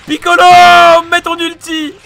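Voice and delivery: high, very loud desperate yelling; panicked scream. Real, not acted. Player begging Piccolo for his ulti, panicked scream. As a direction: scream for help as all goes wrong.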